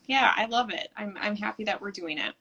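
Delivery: ordinary talk, nothing marked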